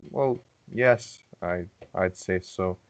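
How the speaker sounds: usual speaking voice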